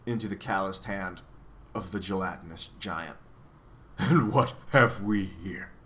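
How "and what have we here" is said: slow, put-on giant's voice; understated roar. A narrator's reading. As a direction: draw it out as a giant, roaring only mildly. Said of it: "he roared".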